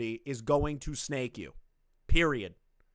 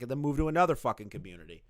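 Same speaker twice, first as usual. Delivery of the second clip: ordinary delivery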